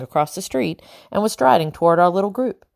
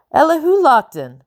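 high, loud hail across the street; called out; 10 semitones higher, 5 dB louder than usual. A narrator's reading. Bellingham hailing Lockton across the street, calling loudly. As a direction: call his name loudly from afar. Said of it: "Bellingham called".

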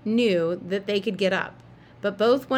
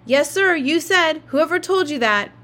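yelling; 8 semitones higher, 6 dB louder than usual. Acted. Jamie still yelling.

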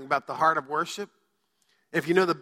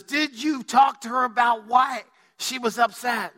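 exasperated shout; 6 semitones higher than usual. Retold or imitated. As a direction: demand it, raised and slow. Pastor retells confronting the callers.

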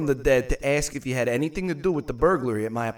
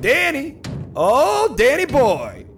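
calling out loudly; 12 semitones higher, 7 dB louder, slower than usual. Acted. Calling at the castle door.

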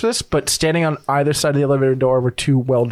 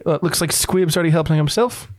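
ordinary talk, nothing marked

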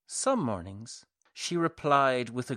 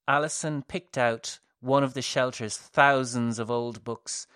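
usual speaking voice

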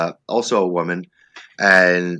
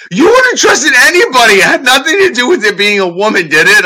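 very high, loud exasperated retort; exasperated wife; 11 dB louder than usual. Retold or imitated. Wife loudly pointing out the comic trusts nobody. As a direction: shout it with disbelief. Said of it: "She goes".

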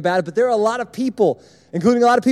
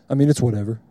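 lower, deep bored monotone; monotone Kason; 8 semitones lower, faster than usual. Retold or imitated. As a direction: say the good news like it means nothing. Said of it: "in a monotone".

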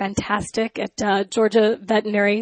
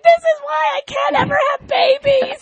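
very high, louder comic wail; mock hysterical crying; 4 dB louder than usual. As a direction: wail it like an overblown meltdown. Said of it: "I'm hysterically crying".

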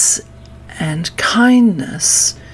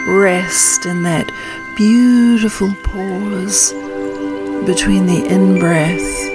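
calm grounding exercise. Acted. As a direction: guide them gently into stillness. Leads a meditation.

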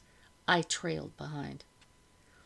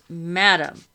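loud, stern interruption; 11 dB louder than usual. A narrator's reading. Officer silencing madam's tirade, stern. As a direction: cut in sternly with authority. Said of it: "Bellingham said sternly".

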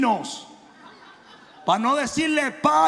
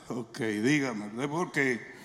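lower, softer and slow, deadpan; mock deadpan reply; 10 semitones lower, 7 dB quieter, much slower than usual. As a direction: answer flat as if unimpressed. Pastor retells his deadpan reply. (respuesta seca y lenta, en tono más grave)

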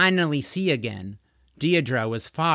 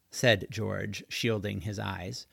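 plain reading voice

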